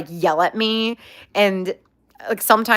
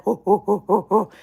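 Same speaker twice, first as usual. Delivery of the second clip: pig hot panting. Retold or imitated. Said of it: "it almost sounds like a monkey".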